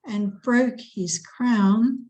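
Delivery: slow loud stress on "broke"; stress on broke and crown. Shown as a demonstration. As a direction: hit "broke" and "crown" slowly. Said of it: "Where is the emphasis".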